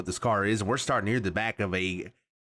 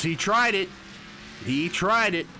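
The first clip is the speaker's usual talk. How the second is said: indignant outburst; 6 semitones higher than usual. Real, not acted. Outraged at Fireball's move.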